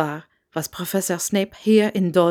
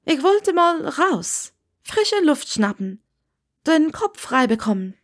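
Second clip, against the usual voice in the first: put-on higher young Hermine; 7 semitones higher than usual. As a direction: drop a heavy hint to be left alone. Hermine hints she wants to leave.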